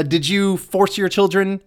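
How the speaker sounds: normal talking voice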